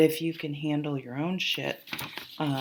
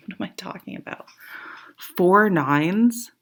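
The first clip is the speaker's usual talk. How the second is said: hushed, amazed whisper; awed whisper; 4 semitones higher, 7 dB louder than usual. Real, not acted. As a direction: whisper it in awe. Awed at four nines.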